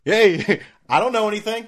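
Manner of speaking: cheerful mock dunce; dopey class clown. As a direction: play the proud know-nothing kid. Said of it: "just be like".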